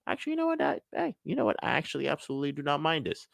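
squeaky high, cheerfully unbothered; high mock cheerful. Salesperson mocking calm about rejection, high and breezy.

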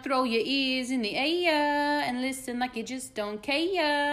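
loud hype show intro; announcer voice. Acted. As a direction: belt it like a radio host. Podcast intro announcer opening.

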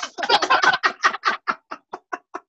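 high airless burst of laughter; cracking up without voice; high breathless laugh. Real, not acted.

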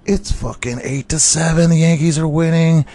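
high, loud burst of thrill; excited shout. Retold or imitated. Fan retells the Yankees' ninth-inning comeback, excited shout.

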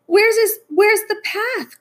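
very high-pitched wail of alarm; distressed cry. Acted. Toad blinded by ice cream, distressed cry. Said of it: "cried Toad".

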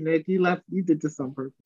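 ordinary delivery

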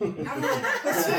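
laughing. Real, not acted. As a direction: laugh it off while refusing. Laughing dodge of question.

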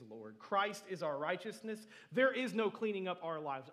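building loud preacher proclamation; fervent preaching. Real, not acted. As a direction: proclaim it with mounting force. Preaches at full force.

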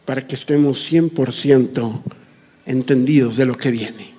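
ordinary talk, nothing marked

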